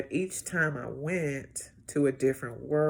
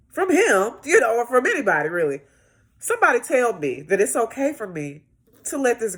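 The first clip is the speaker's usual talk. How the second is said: higher, louder, building; impassioned outburst; 6 semitones higher, 7 dB louder than usual. Real, not acted. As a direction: pour it all out. Rising emotional outburst.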